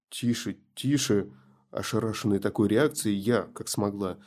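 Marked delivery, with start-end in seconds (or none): 0.1-1.3 calm her down gently; soothing hush. Acted. Lulling Sonya back to sleep.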